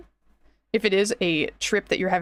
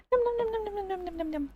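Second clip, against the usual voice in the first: nom nom eating sounds; 11 semitones higher, 7 dB quieter, faster than usual. Pretend. Pretend eating snack.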